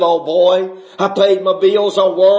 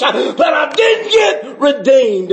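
preaching at full force; 5 semitones higher than usual. Real, not acted. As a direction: cry it out to the church, building to a peak. Testimony rising to full preaching.

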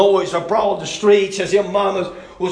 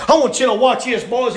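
normal talking voice

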